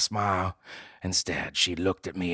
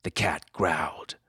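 ordinary delivery

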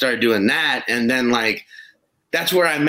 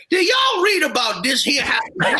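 high, hollered Cajun question to the crowd; shouted Cajun twang, high; Cajun accent; 12 semitones higher than usual. Retold or imitated. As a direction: ham up a Cajun lady reading the news. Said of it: "Cajuned up".

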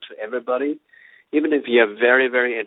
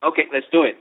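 ordinary talk, nothing marked

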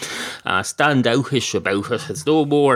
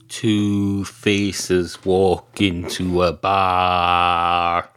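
drawn-out joke teller voice, much slower; slow storyteller voice; 6 semitones lower, much slower than usual. Pretend. Joke-teller setting up a bar gag, very slow and drawn out. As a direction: stretch each word like a tall tale opener.

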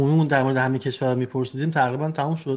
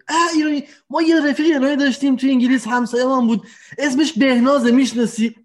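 high, fast eager neighbour voice; imitating English neighbour; 9 semitones higher than usual. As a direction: mimic the chatty neighbour asking excitedly. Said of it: "بعد تا همون زبان من دیدن اینا". (تقلید همسایه انگلیسی با صدای زیر و تند)

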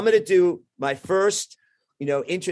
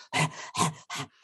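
mock out-of-breath gasps; short, voiceless puffs of breath; winded panting; 7 dB quieter than usual. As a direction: pant hard as if after a sprint. Acting out running to the stage.

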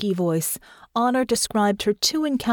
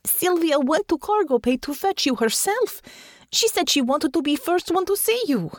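high-pitched protesting explanation; crying out; 10 semitones higher than usual. A narrator's reading. Natasha explains who fetched Honor, crying out. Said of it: "Natasha cried".

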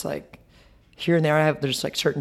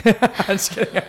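laughing; 6 semitones higher, 4 dB louder than usual. Real, not acted. Laughs after joke.

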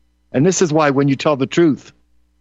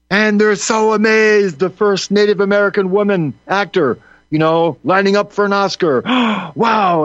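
mock amazement; 5 semitones higher than usual. Host mocking amazement at an Oscar nominee, exaggerated wonder. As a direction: gush in mock awe, ending on a gasped wow.

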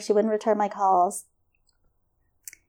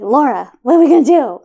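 retold panic; 4 semitones higher, 10 dB louder, faster than usual. Retelling panicked call to friend.

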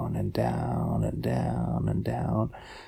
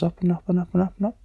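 quick words climbing higher; 7 semitones higher, much faster than usual. A narrator's reading. Narrator following the hole upward to the surface, climbing quickly. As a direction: let the pitch rise with each repeat.

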